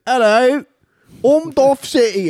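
very high, loud mock Cockney; Sidious. Mocking friend's British claim, absurd high Cockney villain. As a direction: squeal it out in a silly Cockney accent. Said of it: "You think he's like".

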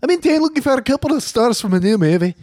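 high-pitched, hammed-up Irish playwright; Irish accent. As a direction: lay on a shaky Irish accent. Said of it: "I gotta work on that accent".

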